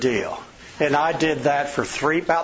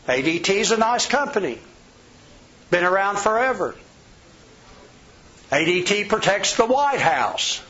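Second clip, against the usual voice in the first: raised, upbeat praise of a rival; mock compliment; 5 semitones higher than usual. Shown as a demonstration. Showing sales staff how to praise a rival, upbeat. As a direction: talk up the competitor like a friendly salesman. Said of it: "Compliment 'em".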